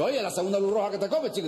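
high-pitched startled protest; alarmed passenger. Acted.

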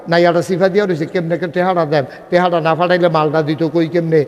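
normal talking voice

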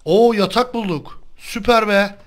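high, loud slow happy cry; excited whoop; delighted cheer. Real, not acted. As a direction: celebrate the find. Cheering finding a bed.

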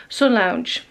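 ordinary talk, nothing marked

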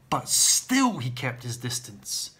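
normal talking voice

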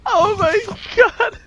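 high wailing laughter; laugh-cry at the chaos. Real, not acted. Laughing-crying at death.

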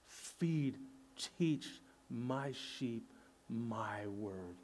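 slow, soft and low charge; gentle command. Retold or imitated. Jesus gently charging Peter to teach, slow and soft. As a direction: lay it on him gently, word by word. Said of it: "Jesus is telling Peter".

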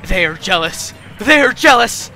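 loud grumbling rising to a high yell; flaming temper. A narrator's reading. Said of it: "He's in a flaming temper".

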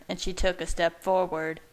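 usual speaking voice

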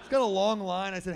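ordinary delivery

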